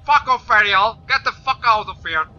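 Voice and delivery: loud, high yell at the enemy; angry yell. Real, not acted. Gamer yelling at the mermaid enemy to back off, furious. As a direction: tell her off at full voice.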